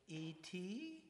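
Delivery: lightly voiced wondering question. Daughter in and out of body sees the monitor, wondering. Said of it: "like, wondering".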